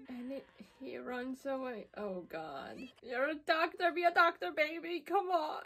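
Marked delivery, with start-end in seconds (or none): 3.0-5.6 loud, high, desperate urging; pleading yell. Real, not acted. Viewer yelling at the doctor on screen, pleading loud. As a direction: yell at the screen to save her. Urging anime character to save her.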